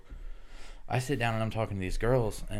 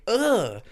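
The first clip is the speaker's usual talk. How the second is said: high, louder "ugh" of disgust; retold disgusted groan; 13 semitones higher, 6 dB louder than usual. Storyteller finds styrofoam in his taco, high disgusted groan. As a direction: groan as if finding junk in your food.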